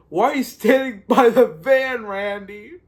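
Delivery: high, loud exasperated yell with a laugh in it; yelling at the screen. Retold or imitated. Viewer yelling at Randy on the screen, exasperated mock yell. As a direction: playfully holler it at a movie character. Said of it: "you're yelling at the screen".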